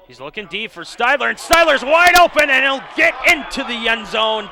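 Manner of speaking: rising excited shout into the end zone; touchdown call. Real, not acted. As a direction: call the touchdown at full roar.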